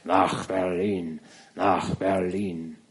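langsam, fern von der Straße herauf, dumpf; ferner Schrei der Menge. Acted. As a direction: wie ein ferner Ruf vom Boulevard. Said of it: "dröhnte heiser und abgerissen der Schrei". (fern und gedehnt gerufen)

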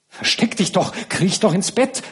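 high, frightened urging; cried out in alarm. A narrator's reading. Nana hides the old Marquis from the count, alarmed. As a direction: hurry him under the covers in panic. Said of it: "rief Nana entsetzt".

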